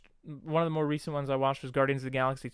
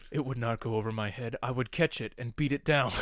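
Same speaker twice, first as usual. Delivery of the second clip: low, literal-minded Drax voice; lightly imitated Drax, pitched down; Drax impression; 4 semitones lower than usual. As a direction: drop the pitch and state it in earnest. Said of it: "And he's like".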